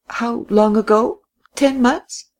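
high, light querying voice; high rising query; wondering question. A narrator's reading. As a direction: ask with voice lifted right up. Woman questions returning guest.

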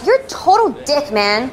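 high-pitched indignant outburst; real voice breaking out. Acted. Barista finally insults rude customer, high indignant natural voice. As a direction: drop the affectation, burst out high and offended. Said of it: "You're talking".

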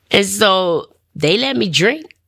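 ordinary talk

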